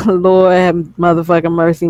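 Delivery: ordinary talk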